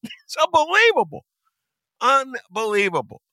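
high-pitched disbelief; incredulous. Real, not acted. Host reacting to the stadium double standard, high incredulous cry. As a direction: squeal it in exasperated disbelief.

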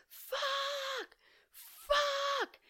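high, strained curse at nothing; swearing at the jury summons in her apartment, high and strained; mock frustrated swearing. Venting alone at home after the jury notice, high strained swearing. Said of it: "I'm like".

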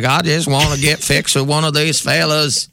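thick mock Southern accent; Hannah. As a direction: imitate a woman slipping into full Southern twang. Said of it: "trying to drop the accent on night one".

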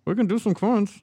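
hollow voice, like talking into a cup. Real, not acted. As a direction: speak into a cup. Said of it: "going on with your voice? ... like you're talking into a cup".

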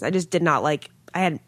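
usual speaking voice

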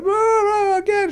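high swooping mock plea from the audience. Mimicking the matinee crowd begging for an encore, high mock whine. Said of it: "people that were going".

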